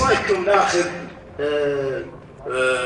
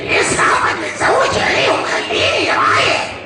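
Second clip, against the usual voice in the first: impression; 10 semitones higher than usual. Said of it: "أقول".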